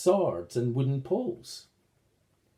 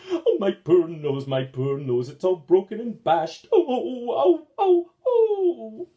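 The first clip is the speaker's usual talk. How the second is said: mock sobbing; 6 semitones higher, 6 dB louder than usual. Goblin laments broken nose.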